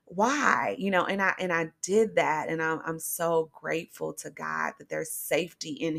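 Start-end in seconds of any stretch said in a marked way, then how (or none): 0.0-0.8 crying out to God. Retold or imitated. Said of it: "crying out".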